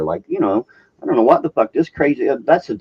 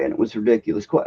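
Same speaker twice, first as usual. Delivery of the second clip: ordinary talk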